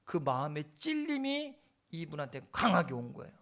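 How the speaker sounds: ordinary talk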